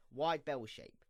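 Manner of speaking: ordinary talk, nothing marked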